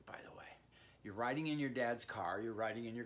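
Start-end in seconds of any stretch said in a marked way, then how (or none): 0.1-0.4 whispering. Real, not acted. Aside explaining one point perspective.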